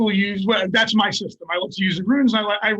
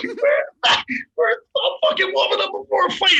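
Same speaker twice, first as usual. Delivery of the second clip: laughing protest; 10 semitones higher than usual. Retold or imitated. Indignant while laughing.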